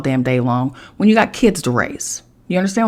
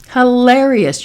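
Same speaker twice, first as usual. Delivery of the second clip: sarcastic; 7 semitones higher, 6 dB louder, slower than usual. Acted.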